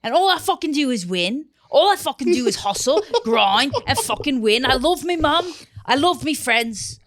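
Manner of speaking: high, loud mock grindset rant; mocking hustle bro. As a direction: ham up a motivational lad bragging. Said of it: "I'm taking the bollocks".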